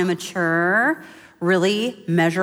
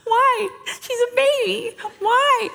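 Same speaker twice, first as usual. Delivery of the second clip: very high, hammed-up wail; mock-frantic mom; slower than usual. New mother protests to her mentor, mock-tearful panic.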